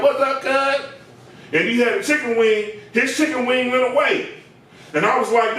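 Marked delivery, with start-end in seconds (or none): 0.0-0.9 higher, put-on Crip greeting; imitated greeting. Comic imitating the customer's cuz greeting. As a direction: throw it out like a gang greeting. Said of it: "as soon as he said".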